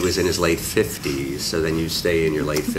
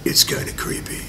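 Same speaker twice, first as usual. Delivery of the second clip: breathy creepy horror whisper; 4 dB quieter than usual. Shown as a demonstration. Actor redoing his horror voice on request, creepy whisper. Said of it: "You wanna hear my horror voice again?".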